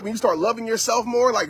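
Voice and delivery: ordinary talk, nothing marked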